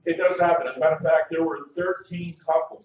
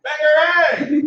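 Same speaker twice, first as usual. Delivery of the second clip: high, loud, slow shouted cue; host demoing the shout for the crowd; 11 semitones higher, 6 dB louder, much slower than usual. Shown as a demonstration. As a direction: stretch the cue word out. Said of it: "I want you to shout".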